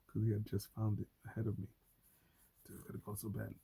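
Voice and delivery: ordinary talk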